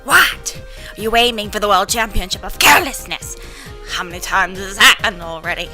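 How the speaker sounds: loud scolding grandma; foul mouthed granny. Acted. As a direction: berate the careless grandson. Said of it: "my grandma voice is really incongruent".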